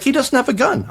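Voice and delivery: high, dismissive lilt; breezy agent. Retold or imitated. Agent brushing off the gun worry, breezy. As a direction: wave it away lightly.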